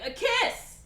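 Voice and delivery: very high, loud outburst; hammed-up burst of eagerness; eager blurt.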